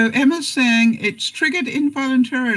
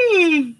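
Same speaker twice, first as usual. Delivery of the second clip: end of yawn sigh; 8 semitones higher than usual. Real, not acted. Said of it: "makes me yawn".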